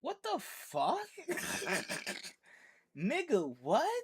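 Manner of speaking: high-pitched incredulous questions; baffled and appalled, voice shooting up; real disbelief. Real, not acted. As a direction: react in stunned, squeaky disbelief. Shocked reaction to scalpel hidden up nose.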